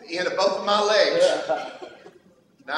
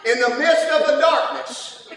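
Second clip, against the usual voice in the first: higher, louder fervent preaching; preaching at full force; 9 semitones higher, 5 dB louder than usual. Acted. Preacher exhorting the congregation about childlike faith, full-force preaching. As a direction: drive it home to the church.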